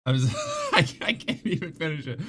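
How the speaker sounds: real laugh cutting up the words; breaking into laughter. Real, not acted. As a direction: crack up and fail to finish. Cracks up mid joke.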